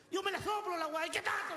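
high-pitched, defiant retort; defiant comadre. Acted. Comadre angrily retorts to policeman. (comadre desafiante, voz aguda)

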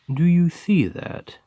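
startled question to the little ghost; sudden surprise. Acted. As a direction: catch sight of something and point it out. Spotting something in the dark.

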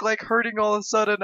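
usual speaking voice

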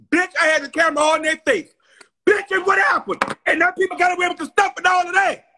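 high, loud and fast ranting; fired-up yelling. Real, not acted. Retelling her car break-in, yelling in outrage. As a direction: rant it out at the top of the voice.

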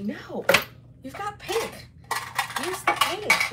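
bright high inner voice nudging; a hint of a coaxing inner voice. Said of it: "something told me".

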